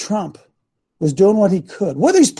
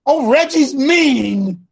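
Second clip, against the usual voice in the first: high, drawn-out mock whine; exaggerated slow mimicry of a complainer; mock complaint; 5 semitones higher, much slower than usual. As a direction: drag it out slowly, higher, mocking the critics. Mocking those angered by Reggie.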